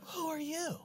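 small, very soft mimicked voice; meek mock patient voice; taken-aback old man. Mimics the patient after being silenced.

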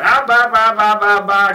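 loud babbling baby impression; baby talk. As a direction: babble like a toddler at the table. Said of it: "talking to baby talk".

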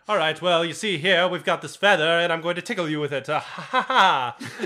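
loud vaudeville comic bit; old-timey comedian. Retold or imitated. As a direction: ham it up like a forties comic. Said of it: "the comedy is just like".

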